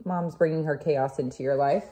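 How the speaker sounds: normal talking voice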